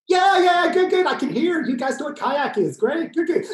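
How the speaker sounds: high, loud delighted praise; excited cheering. Real, not acted. Praising audience clicking along, excited cheer. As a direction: cheer them like a coach.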